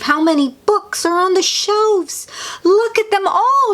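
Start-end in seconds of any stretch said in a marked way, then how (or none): 2.6-3.7 high-pitched excited call; storybook shout, pitched way up. A narrator's reading. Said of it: "she shouts".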